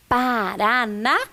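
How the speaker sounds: slow "Paraná", syllables stretched and left hanging. Shown as a demonstration. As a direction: read Paraná out slowly, in pieces. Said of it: "N com A". (sílabas esticadas, devagar)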